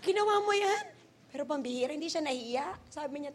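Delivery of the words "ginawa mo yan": high, mock-scandalised question; comic disbelief; retold shock. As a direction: react as if appalled, pitched up.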